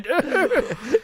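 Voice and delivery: put-on high wheezy old-guy cackle; mock old-guy laugh, pitched high; old-man laugh. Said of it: "you laugh just like the old guy".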